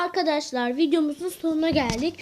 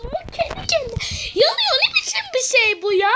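frustrated; 10 semitones higher than usual. Real, not acted. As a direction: complain shrilly at the unfair game. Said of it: "Sinirim bozuldu".

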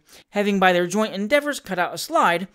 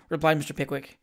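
usual speaking voice

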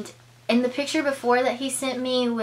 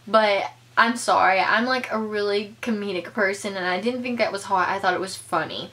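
ordinary talk

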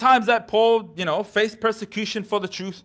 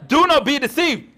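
loud, forceful preaching from scripture; emphatic scripture reading; 2 semitones higher, 7 dB louder than usual. Real, not acted. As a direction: declaim each item of the list loudly. Declaims a scripture list to church.

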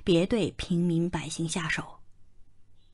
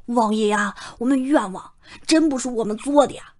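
higher, louder plea of innocence; crying out; 5 semitones higher, 5 dB louder than usual. A narrator's reading. A refugee crying out to the prince, pleading loudly. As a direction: cry out your innocence to the prince. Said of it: "用绕口的官话叫道".

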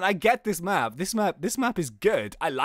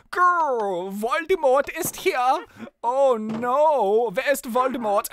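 high, slow, hammed-up German accent; 5 semitones higher, 3 dB louder, slower than usual. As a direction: growl into a theatrical German-accented alarm. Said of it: "The German Voldemort".

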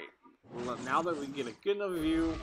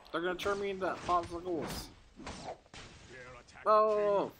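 usual speaking voice

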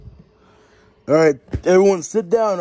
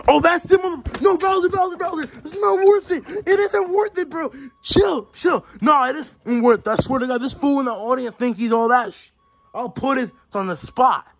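higher-pitched, heated character voice holding back a brawl; hyped-up fighter character; 6 semitones higher than usual. Acted. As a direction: play a riled-up fighter getting pulled apart.

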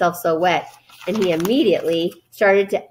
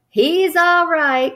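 high, drawn-out anxious call; raised, insistent cry; 9 semitones higher, 4 dB louder, much slower than usual. A narrator's reading. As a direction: call out slowly to reassure the crowd. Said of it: "cried Mister Zuckerman".

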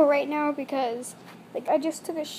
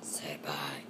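soft breathy whisper to pet; 13 dB quieter than usual. Real, not acted. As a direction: whisper gently to the bunny.

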